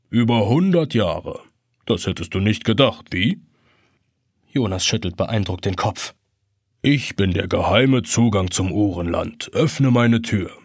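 put-on low, gentle old clock; gentle grandfather clock. A narrator's reading. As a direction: ancient clock gently teasing the boy.

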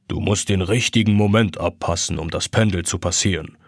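ancient clock nudging him on; low clock voice. A narrator's reading. Grandfather clock instructs.

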